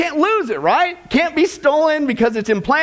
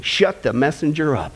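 ordinary talk, nothing marked

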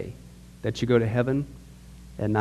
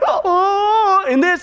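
high drawn-out mock wail; mock wailing cry; 13 semitones higher, 3 dB louder, much slower than usual. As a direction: imitate people crying out.

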